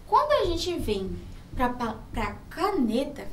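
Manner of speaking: ordinary delivery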